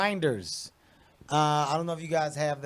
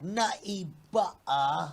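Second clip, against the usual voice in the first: slow, drawn-out mock British accent; 2 semitones higher, 4 dB quieter, much slower than usual. Shown as a demonstration. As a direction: politely stretch it out in British. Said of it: "do your best British accent".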